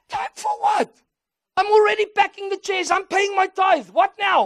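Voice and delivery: high, startled, protesting; surprised protest. Retold or imitated. Startled reply to pastor's call.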